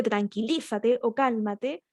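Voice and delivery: normal talking voice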